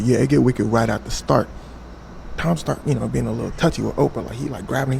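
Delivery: usual speaking voice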